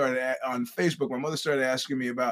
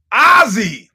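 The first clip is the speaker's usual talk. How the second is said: loud reveal; 9 semitones higher, 15 dB louder than usual. Retold or imitated. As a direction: blurt the name out loud and high. She reveals the name.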